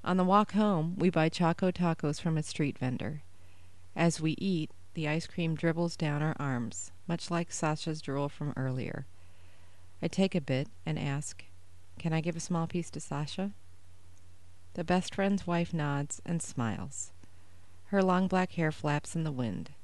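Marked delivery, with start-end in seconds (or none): none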